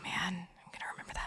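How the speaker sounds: mock menacing whisper; joking whisper to co-host about his tangent. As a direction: whisper it like a playful threat. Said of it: "whispered threat".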